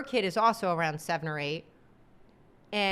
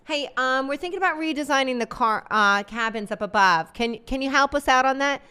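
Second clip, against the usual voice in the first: higher, brisk friendly call; mocking United phoning a flight attendant; pretend corporate caller; 4 semitones higher, 5 dB louder than usual. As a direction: sweet-talk Sally with a question. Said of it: "calling the flight attendant".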